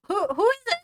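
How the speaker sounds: put-on high, loud startled old woman; startled voice at the door. Old dwarf woman calling through the door. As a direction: jump up high and call out.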